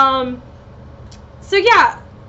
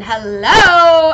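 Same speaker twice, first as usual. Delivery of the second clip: excited; 4 semitones higher, 9 dB louder than usual. Real, not acted. Exclaiming about Lando Calrissian.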